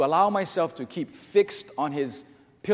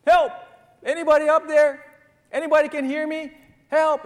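high, loud desperate call up a cliff; yelling for help; 12 semitones higher, 9 dB louder than usual. Acted. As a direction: holler as if stranded and hoping someone hears. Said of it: "yell out at the top of his lungs".

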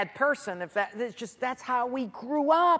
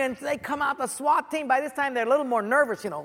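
ordinary talk, nothing marked

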